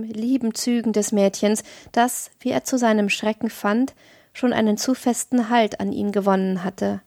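plain reading voice

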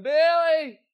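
high, loud cries of the name; a trapped mother crying out to her son; desperate cry. Acted. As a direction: cry out for him as the rock falls. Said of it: "she called out one last time".

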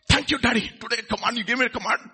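mock delight at being told what to do; eager obedient child. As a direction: pretend child thanking a parent. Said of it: "Have you seen that?".

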